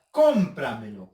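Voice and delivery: "Cómpramelo" with a loud first syllable; CÓM-pramelo, hit hard at the start; stress on CÓM. Shown as a demonstration. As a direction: punch "Cóm", let the rest fall. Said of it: "identificamos la sílaba tónica".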